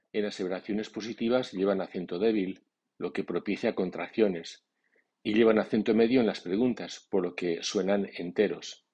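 normal talking voice

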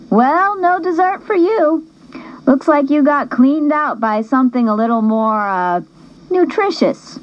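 high, lightly voiced girl teasing; teasing best friend. Darla ribbing the narrator after the auction, light high teasing.

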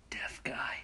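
very soft conspiratorial whisper; secret whisper. Retold or imitated. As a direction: whisper it as a sly cue. Coworker secretly cues the prank.

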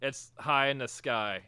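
ordinary talk

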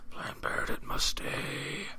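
slow whispered demon impression; mock a friend in a drawn-out demonic whisper. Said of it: "It's like your demon voice".

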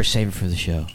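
lightly voiced inner mentor; a hint of the friend's voice in his head. Host quoting the friend's voice in his head, lightly imitated. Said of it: "I always hear".

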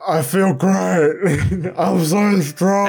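high, very slow, laughing through it; mock six-hundred-pound me. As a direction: stretch each word, high and slow, cracking up. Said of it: "And I'm gonna be like".

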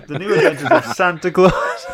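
correcting himself through laughter; laughing. Real, not acted. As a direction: laugh while fixing the slip. Laughing at his own slip.

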